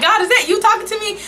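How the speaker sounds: squeaky high, amused disbelief; high incredulous. Retold or imitated. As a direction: ask it in a high, giggly wonder. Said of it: "I'm like".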